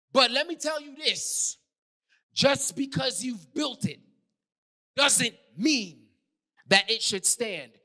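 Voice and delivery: emphatic preacher driving the point home; full-force preaching. Real, not acted. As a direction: preach it hard, landing on key words.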